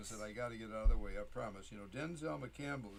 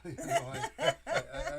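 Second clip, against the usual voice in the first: laughing; 9 dB louder than usual. Real, not acted. Laughing at his own poem.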